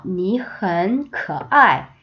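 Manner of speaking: very slow, syllable by syllable; slow teacher demo; slow model reading. Shown as a demonstration. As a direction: spell out 你很帅 slowly. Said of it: "우리 이 표현 배울 때".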